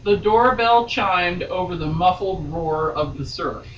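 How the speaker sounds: steady read-aloud novel voice; story narration. A narrator's reading. Reading novel aloud on podcast.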